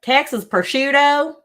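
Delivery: loud, high, drawn-out Texas twang; hammed-up Texan drawl, stretched wide; Texas accent. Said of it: "That's how you say it".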